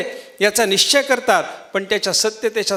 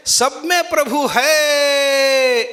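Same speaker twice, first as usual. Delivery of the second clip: very slow, higher, louder braying chant; donkey bray; 6 semitones higher, 4 dB louder, much slower than usual. Retold or imitated. Preacher imitating the braying donkey, drawn out. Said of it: "ते गाढव असं सांगत असतं म्हणे".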